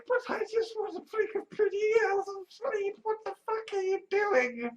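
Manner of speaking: high, soft mock whine; high, soft mock sob; mock whining. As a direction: whimper it like a tired kid begging for sleep. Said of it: "And I'm just like".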